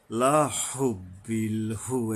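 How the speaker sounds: হুব্বিল with a breathy throat ha; breathy long ha. Shown as a demonstration. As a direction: push air through the throat on the ha of হুব্বিল. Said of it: "লম্বা হা উচ্চারণে সাবধান".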